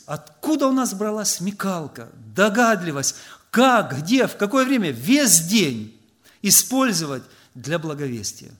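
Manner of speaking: ordinary talk, nothing marked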